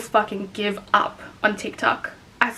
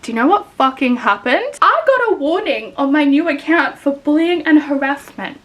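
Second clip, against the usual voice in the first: ordinary talk, nothing marked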